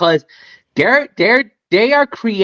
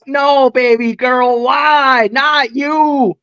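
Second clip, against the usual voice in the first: higher, drawn-out mock plea; dismayed pleading; 4 semitones higher, much slower than usual. Fan begging Tiffany not to interfere, dismayed pleading. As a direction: plead with her, stretching the words.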